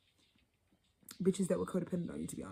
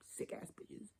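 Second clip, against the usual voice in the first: whispered insult; 13 dB quieter, slower than usual. Real, not acted. Muttered insult under breath.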